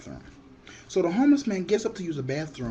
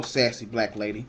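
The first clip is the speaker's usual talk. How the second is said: normal talking voice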